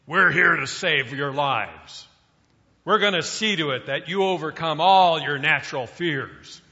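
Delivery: loud barking drill sergeant voice; raspy drill sergeant. Retold or imitated. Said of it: "I can still hear the raspy voice of the sergeant".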